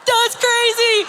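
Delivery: high-pitched mock astonishment; freaking out. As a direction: blurt it as if your mind is blown. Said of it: "we all freak out".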